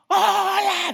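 high, loud shouted greeting from afar; calling hello. Retold or imitated. Someone across the street calling out hello.

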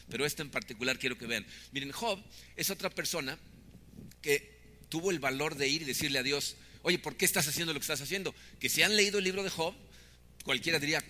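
normal talking voice

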